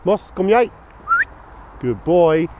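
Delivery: high, loud call to the dog. Real, not acted. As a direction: call him back cheerfully. Calling the dog back.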